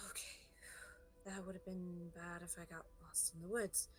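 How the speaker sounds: soft, sighed-out admission; sighing. Acted. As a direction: let it out softly on a sigh. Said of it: "she kinda takes a sigh".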